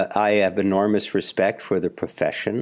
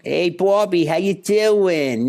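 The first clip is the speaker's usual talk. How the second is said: put-on Philadelphia accent; 9 semitones higher than usual. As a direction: do a broad Philadelphia cousin. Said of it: "talked like".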